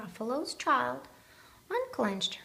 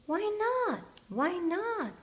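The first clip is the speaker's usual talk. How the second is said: put-on child's question; 9 semitones higher than usual. Child asks father Gruffalo.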